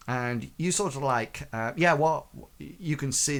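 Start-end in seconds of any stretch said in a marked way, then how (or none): none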